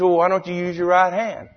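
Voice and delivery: high, baffled mock-Cajun protest; Cajun storyteller. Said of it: "tells the story in his hilarious Cajun accent".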